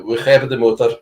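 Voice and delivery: Dutch movie line said in Dutch. Shown as a demonstration. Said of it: "the first line in the movie is".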